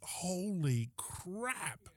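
very slow, stretched-out exclamation; drawn-out amazement. Retold or imitated. Man reacting to hearing of weeks-long wear, drawn-out amazement. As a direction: draw each word right out in awe.

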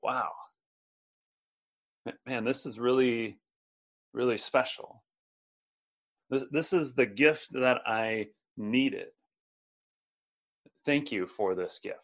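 quiet wonder at a needed gift; heartfelt thanks. Pretend. Paul thanking God for the gift, soft and sincere. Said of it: "he's opening up this gift and he's saying".

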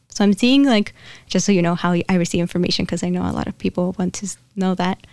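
ordinary talk

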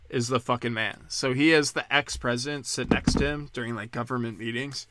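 ordinary delivery

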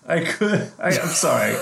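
high, giggling apology through laughter; trying not to laugh and failing; stifled giggles. Real, not acted. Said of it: "I really tried not to laugh at that".

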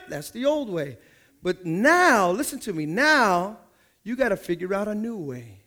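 ordinary talk

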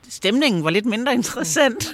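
high, loud, breaking into laughter; amused understatement. Real, not acted. Storyteller on the mood after the ghost cleanser left, amused.

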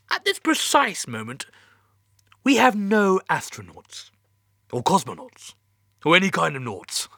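high, nervous with a forced laugh. Acted. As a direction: cover bad news with a laugh. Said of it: "He sounded nervous".

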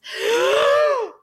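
sharp, high gasp of dismay; shocked gasp. Real, not acted. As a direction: gasp as if struck by the news. Reacting to a character's death.

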